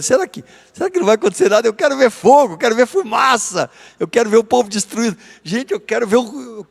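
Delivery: a hammed-up prophet itching to see fire; mock-gleeful Jonas craving chaos; eager for destruction. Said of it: "É como se ele estivesse dizendo assim".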